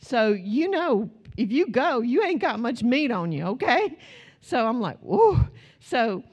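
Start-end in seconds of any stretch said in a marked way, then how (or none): 5.0-5.4 high, loud playful whoop. Retold or imitated. Mother whooping at turbulence, high and loud. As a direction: cheer the drop.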